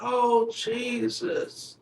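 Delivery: high, soft, slow wailing plea; thin, drawn-out fake weeping; mock crying. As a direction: imitate grandma sobbing out a prayer. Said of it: "she really starts crying".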